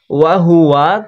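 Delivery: ordinary delivery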